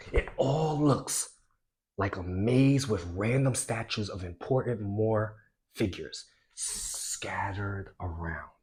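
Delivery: cartoonish storytelling, big swings from loud to whispered; animated reading. Shown as a demonstration. Teacher showing students a lively reading, cartoonish and animated. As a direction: read it like a silly cartoon for kids. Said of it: "the way I read that ... be crazy, be cartoonish".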